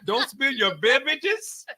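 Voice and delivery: put-on laughing light African accent. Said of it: "The way that African accent".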